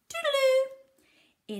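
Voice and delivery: high, slow, loud toodaloo; a cheery drawn-out goodbye call; sing-song farewell. Acted.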